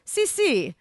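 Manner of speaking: high, quick-witted Italian yes; lifted Italian affirmation; eager agreement. A narrator's reading. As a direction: agree eagerly as the foreign translator.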